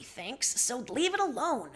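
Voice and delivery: ordinary delivery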